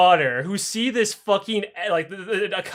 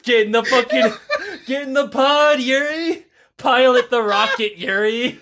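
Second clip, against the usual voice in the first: mock order; 5 semitones higher, 4 dB louder than usual. Voicing mission control ordering Yuri.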